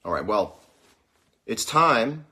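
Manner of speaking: normal talking voice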